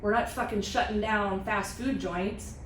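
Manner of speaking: usual speaking voice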